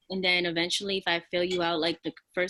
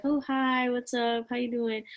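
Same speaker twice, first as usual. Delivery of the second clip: higher, softer reserved greeting; mildly acted standoffish small talk, keeping a distance; 3 semitones higher, 3 dB quieter than usual. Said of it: "like, standoffish".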